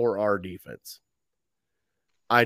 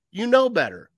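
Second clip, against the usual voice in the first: higher and louder, heated scold; fired-up scold; 7 semitones higher, 4 dB louder than usual. Retold or imitated. Coach chewing out a player after a penalty, heated scold. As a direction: get in his face. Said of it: "grab his face mask and like".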